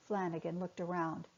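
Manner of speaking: ordinary delivery, nothing marked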